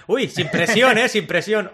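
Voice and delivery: high, loud laughing sarcasm; mock it through a laugh; sarcastic. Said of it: "Es en plan".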